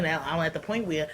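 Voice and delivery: ordinary talk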